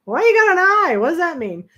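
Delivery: high, loud curious call of Hey; eager question. Retold or imitated. Asks the room steward about his name, high and eager. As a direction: ham up a nosy question. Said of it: "you know me, I was like".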